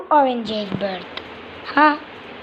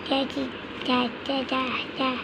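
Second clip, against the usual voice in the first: gentle coaxing syllables, much softer; soft baby-talk coaxing; 11 dB quieter than usual. Pretend. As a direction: quietly sound out da-da for a baby. Coaching a baby bird to talk.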